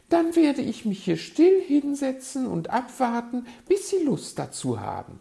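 high, loud magpie character voice; put-on high voice, stubbornly polite. A narrator's reading. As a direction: pitch it way up as an insistent bird.

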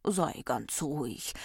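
slow, gentle reassurance; calm someone who is crying, slowly; soothing. A narrator's reading. Recht comforts the weeping Ordnung, soothing.

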